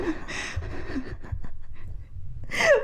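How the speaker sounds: breathy, voiceless giggling fit; helpless laughter trailing on; long laugh. Real, not acted. Laughing over confusing the learner.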